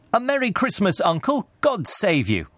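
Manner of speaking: high, hearty festive greeting; cheery greeting. A narrator's reading. Nephew surprises his uncle with Christmas wishes, cheery and high. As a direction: hail him merrily, pitched well up.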